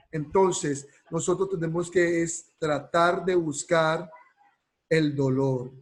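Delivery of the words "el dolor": normal talking voice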